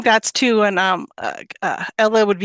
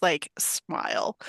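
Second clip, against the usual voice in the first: whispered; 5 semitones lower, 10 dB quieter, slower than usual. A narrator's reading. Describes a hidden smile.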